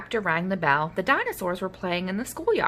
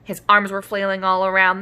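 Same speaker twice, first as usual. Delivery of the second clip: ordinary delivery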